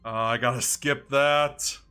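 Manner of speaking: ordinary talk, nothing marked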